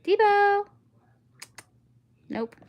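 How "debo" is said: loud high call of a pet's name; loud sing-song call of a name. Real, not acted. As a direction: call the dog over from across the room. Calling the pet by name.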